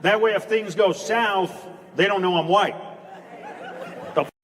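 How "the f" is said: high, fast sassy street voice. Shown as a demonstration. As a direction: snap at customer service in a pitched-up put-on voice.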